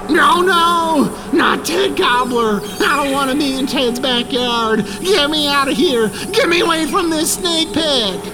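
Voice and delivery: hammed-up terror; panicked pleading. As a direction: plead desperately to be let out. Begging to escape the snake pit.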